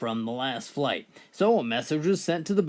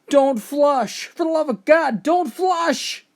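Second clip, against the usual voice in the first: much higher, louder panicked shout; panicked yelling; 11 semitones higher, 7 dB louder, slower than usual. Retold or imitated. Blind guy in the pool yelling in panic, panicked shout. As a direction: scream it out in terror. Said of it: "The blind guy started yelling".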